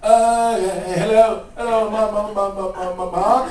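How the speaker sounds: loud, high, stammering rock-star impression. Rock singer phoning a songwriter early morning, stammering caricature. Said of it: "I hear this voice".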